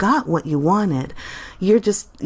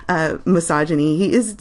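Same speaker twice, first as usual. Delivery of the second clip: ordinary delivery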